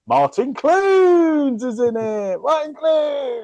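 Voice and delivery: high, slow, drawn-out announcement; milk the name like a big reveal; mock dramatic reveal. To the podcast friends, hammed-up slow high reveal.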